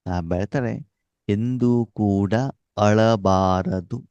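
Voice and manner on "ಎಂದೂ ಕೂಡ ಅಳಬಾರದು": very slow, each word drawn out; slow, drawn-out teaching stress; slow emphasis. Shown as a demonstration. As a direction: stretch it so the class repeats it. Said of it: "ಎಂದೂ ಕೂಡ ಏನ್ ಮಾಡೋದು ಅಂತೆ?".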